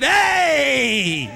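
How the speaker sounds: an excited Italian shout of hey. Retold or imitated. East Coast Italian excited hey, shouted. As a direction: throw out a happy hey. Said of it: "when we're excited".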